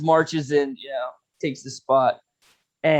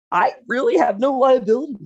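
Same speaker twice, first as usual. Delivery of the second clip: smug lecture; 9 semitones higher, 4 dB louder than usual. Retold or imitated. Lecturing the judge smugly.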